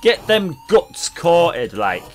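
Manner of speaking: loud, hammed-up northern lad; northern accent. Streamer urges viewers to eat northern bread in a loud northern accent. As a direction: boom it like a proud Lancashire lad, northern accent. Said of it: "I'm dead good on accents".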